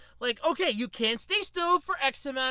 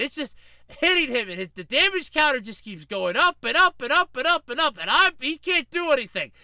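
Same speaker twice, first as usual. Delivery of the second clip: usual speaking voice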